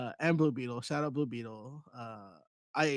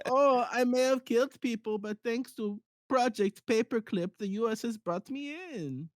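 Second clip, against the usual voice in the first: put-on shitty German accent; 9 semitones higher than usual. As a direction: do a bad, high-voiced mock German scientist. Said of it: "in my shitty German accent".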